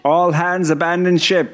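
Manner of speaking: high, slow loud captain's call; shouting abandon ship. Acted. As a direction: bellow it like an order to the crew. Closing sign-off call.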